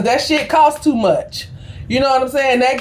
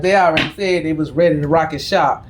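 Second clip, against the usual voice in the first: usual speaking voice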